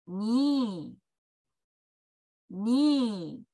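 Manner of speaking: "Knee" starting on a long N; English "Knee" with no K sound; silent K. Shown as a demonstration. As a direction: say "Knee" as nee, drawn out. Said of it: "Kの音が消えて、Nの音だけになります".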